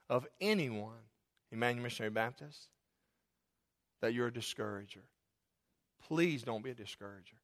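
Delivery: low, soft, solemn appeal; hushed plea. Real, not acted. Pastor begging his church not to discourage, hushed plea. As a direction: drop low and soft, pleading gently.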